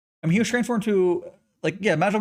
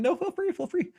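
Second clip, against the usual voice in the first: high silly voice. Pretend. Playful reply to lurker.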